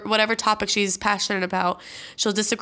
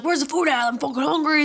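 higher, rowdy mock-uncle demand; all crazy; 4 semitones higher than usual. Uncle barging in for the holiday food, crazy excited. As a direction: imitate a hyped uncle hunting for food. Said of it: "he comes in all crazy".

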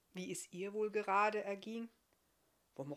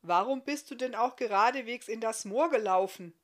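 loud, exasperated reproach; reproachful scolding; 3 semitones higher, 10 dB louder than usual. A narrator's reading. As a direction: scold a foolish stranger loudly. Stranger scolds the stuck giant.

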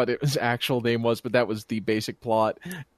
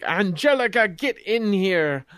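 evil stepmother; 6 semitones higher than usual. Retold or imitated. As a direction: summon her as a mock evil stepmother, stretching the words. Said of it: "the evil stepmother".

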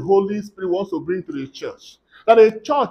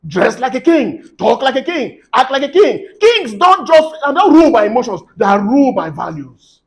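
higher-pitched, punchy preaching; forceful exhortation; 4 semitones higher than usual. Real, not acted. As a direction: urge the congregation with emphatic commands.

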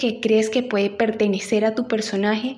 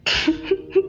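short amused laugh; a quick high giggle; light laugh; 9 semitones higher than usual. Real, not acted. Laugh after quote.

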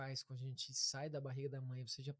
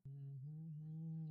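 soft approaching hum; 6 dB quieter, much slower than usual. Shown as a demonstration. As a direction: hum gently as if nearing a fragile baby. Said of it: "eles vinham através do som".

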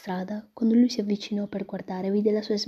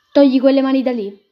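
higher, much louder sharp command; cold rebuff; 5 semitones higher, 13 dB louder than usual. Acted. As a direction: push his hands away with your voice. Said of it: "disse la ragazza in modo freddo".

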